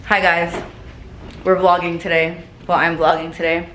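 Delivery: ordinary talk, nothing marked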